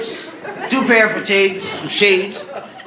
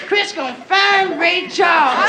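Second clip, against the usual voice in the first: high, slow, tipsy; tipsy Isabel; 9 semitones higher, slower than usual. Acted. Tipsy Isabel cheering at the pier, high and slow. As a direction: slur it out happily.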